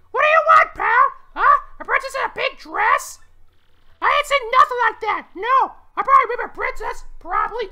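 very high, loud cartoon falsetto; Toad voice. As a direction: pitch it way up and pipe it out loudly. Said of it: "finally do a Toad voice again".

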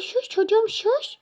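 high, loud hushing at the dog; shushing. Pretend. (köpeği susturur gibi tiz)

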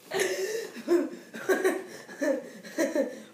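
bursts of giggling; laughing. Real, not acted. Laughing at the balloon.

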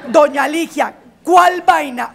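rising exasperation; insistent demand. Acted. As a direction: push the question harder the second time. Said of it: "repeated line, escalated".

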